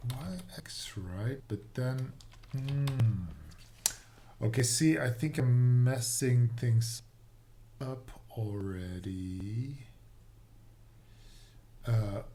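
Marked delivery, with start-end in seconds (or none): none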